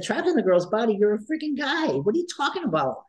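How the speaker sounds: playful high-pitched disbelief; mock disbelief. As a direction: tease him out of it with exaggerated shock. Coach mocking teen's claim playfully.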